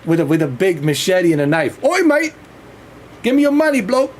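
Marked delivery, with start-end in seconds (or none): 1.8-4.1 mock Cockney mugger. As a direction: play a London robber shaking someone down, overdone. Mimicking a knife robber demanding money.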